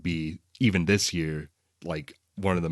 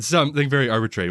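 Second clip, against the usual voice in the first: mock echo; 6 semitones higher, 7 dB louder, faster than usual. Echoing the partner's joke.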